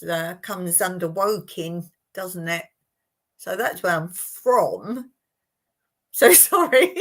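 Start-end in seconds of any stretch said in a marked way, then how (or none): 6.1-6.9 high, loud laughing apology; real laughter breaking into the words. Real, not acted. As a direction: apologise through a burst of laughter. Laughing over a slip in chat.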